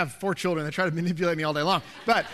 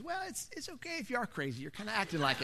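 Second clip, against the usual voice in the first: put-on kind elderly gentleman; 2 semitones higher, 11 dB quieter, faster than usual. Elderly shopper reassuring the man who yelled, softly imitated. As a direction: gently humor him in a small old man's voice.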